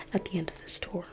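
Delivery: ordinary talk, nothing marked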